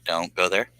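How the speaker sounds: usual speaking voice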